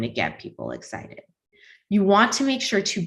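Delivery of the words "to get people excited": trailing off low and quiet; bored, lifeless and much quieter; deadpan monotone. Shown as a demonstration. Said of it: "Talking like this with a straight face".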